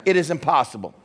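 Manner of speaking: normal talking voice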